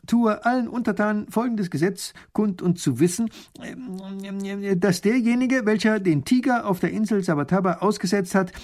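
high, proclaiming voice; pompous ruler's high-pitched dictation; dictating a decree. A narrator's reading. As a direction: proclaim a law for all subjects. Ruler dictating a law to scribe.

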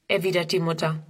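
ordinary delivery

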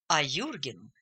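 usual speaking voice

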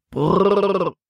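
a rolled brrr to halt the horses; lightly voiced driver reining in; calling whoa to horses. A narrator's reading. Paul calls to his horses to stop, brrr. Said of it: "rief Paul den Pferden zu".

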